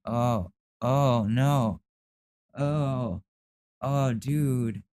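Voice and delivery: high, dismayed oh no, dude; disgusted disbelief; horrified oh no. Real, not acted. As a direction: recoil at the creepy line. Reacting to kidnap joke.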